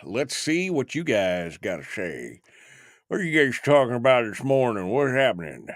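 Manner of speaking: like his teeth are gone; gummy mumbling voice; toothless. Real, not acted. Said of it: "I don't know why I'm talking like this".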